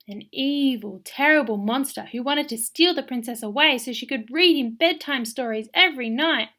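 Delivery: usual speaking voice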